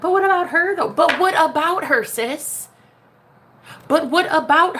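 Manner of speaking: mock an insecure client's whine; whiny client. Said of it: "they come to me and they're like".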